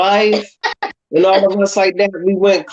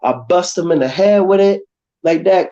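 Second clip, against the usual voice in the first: ordinary talk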